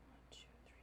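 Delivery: whispered counting; whispering. Real, not acted. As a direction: count under your breath. Counting to herself.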